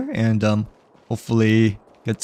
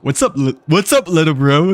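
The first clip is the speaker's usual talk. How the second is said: bright, higher, louder hey little bro; playful greeting; 5 semitones higher, 4 dB louder than usual. Acted. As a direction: greet him like a cool big sibling. Playful greeting to kid character.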